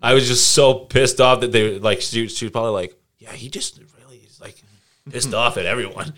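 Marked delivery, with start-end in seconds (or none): 3.2-6.1 mock principal. Principal voiced.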